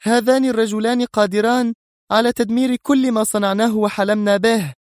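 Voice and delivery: agitated, high-pitched warning; very edgy. A narrator's reading. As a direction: go on with mounting nerves. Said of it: "مستطردة في عصبية زائدة". (بعصبية زائدة)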